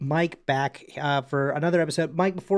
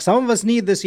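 mock annoyed; 6 semitones higher, 4 dB louder than usual. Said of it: "she's, like, so mad".

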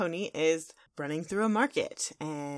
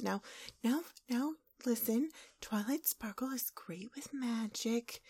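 soft, higher, whispery reassurance; Fluttershy; 5 semitones higher, 7 dB quieter than usual. Acted. Pony talking to her animal friends, softly soothing. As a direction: hush it like a timid pony calming friends. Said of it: "she's talking to her friends".

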